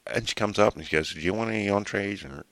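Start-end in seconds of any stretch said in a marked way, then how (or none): 1.1-2.2 lightly imitated American waitress, a little higher and held back; attempted American accent. Waitress asks a table about entrees, attempted American accent. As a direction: have a tentative go at an American accent. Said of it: "did I get that accent right then".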